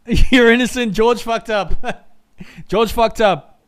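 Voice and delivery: giggling through the words, slowed; laughing. Real, not acted. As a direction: laugh at having blamed the wrong driver. Laughing after the replay.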